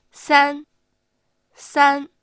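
三 loud, long and high without falling; high level tone. Shown as a demonstration. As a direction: sustain 三 evenly on a high pitch. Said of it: "同じように高く出てく". (「三」を高く平らに伸ばす)

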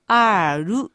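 ある as drawn-out "āru" with a full ru; wrong Japanese-style sound. Shown as a demonstration. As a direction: demonstrate the mistaken ある with two syllables. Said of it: "あるになってしまうので". (「あーる」と伸ばす悪い例)

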